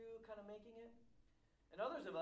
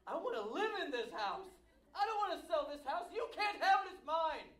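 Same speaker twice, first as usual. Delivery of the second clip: possessive outburst; 8 semitones higher, 10 dB louder than usual. Retold or imitated. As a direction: grow from a hushed sigh to a childish yell. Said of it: "You go".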